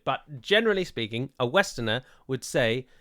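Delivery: normal talking voice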